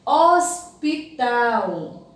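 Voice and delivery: Hospital said very slowly, every syllable stretched; drawn-out pronunciation. Shown as a demonstration. Teacher sounding out a word for pupils, Hospital drawn out. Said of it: "vamos pronunciar em voz alta".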